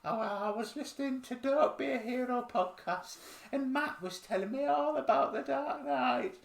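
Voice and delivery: high, put-on chatty driver voice; a high comic caller telling the police all about the podcast; character voice. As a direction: squeak it like a gossipy caller. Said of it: "Feels like a character".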